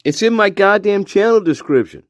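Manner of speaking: high-pitched comic indignation; mock exasperation. Telling viewers about the email address, mock exasperated. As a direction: protest in a high, incredulous voice.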